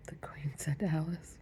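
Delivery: plain reading voice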